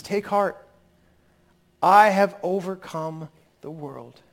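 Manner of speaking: slow, solemn quoting of the verse; solemn scripture quote. Acted. Pastor repeating the verse to comfort listeners, slow and solemn. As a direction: linger on every word like a promise.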